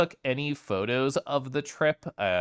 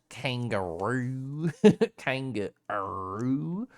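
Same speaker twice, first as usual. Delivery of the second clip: slow drawn-out Southern "Kangaroo"; southern accent; much slower than usual. Shown as a demonstration. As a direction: stretch "Kangaroo" slowly with a Southern drawl, laughing at the end. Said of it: "kangaroo in a southern accent".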